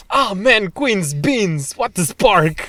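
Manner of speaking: lightly acted game-character outburst; swearing game character. Podcaster imitating Xenoblade characters' invented swearing, mock outburst. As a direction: play a game character cursing in made-up words. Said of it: "you'll have characters being like".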